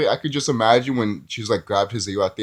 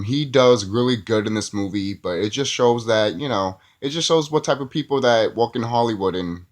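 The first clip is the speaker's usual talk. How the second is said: normal talking voice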